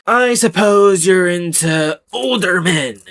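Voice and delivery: a mocking take on a creepy adult flirting; creepy teacher voice. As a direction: put on a slimy, slow come-on voice. Reading the creepy teacher's message.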